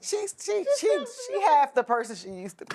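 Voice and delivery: laughing through the words; can't stop giggling. Real, not acted. Laughing while telling.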